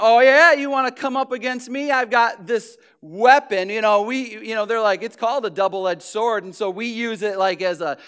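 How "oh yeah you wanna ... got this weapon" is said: louder, taunting boast; hammed-up taunting dare; mock challenge. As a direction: brandish the Bible like a weapon. Mocking a Bible-thumper's challenge.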